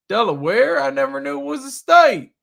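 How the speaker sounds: loud, high-pitched incredulous Southerner; mock a clueless out-of-stater in a Southern accent. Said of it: "I'm using a Southern accent".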